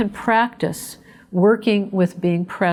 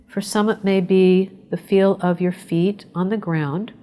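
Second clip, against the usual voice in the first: guided meditation. Acted. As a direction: ease the listeners into calm, breath by breath. Leads a short breathing meditation.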